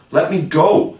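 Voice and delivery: high, loud command; ordering. A narrator's reading. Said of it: "she ordered".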